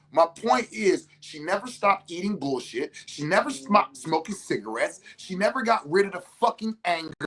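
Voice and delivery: ordinary talk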